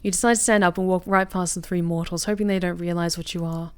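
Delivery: ordinary talk, nothing marked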